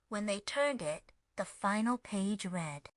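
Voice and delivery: usual speaking voice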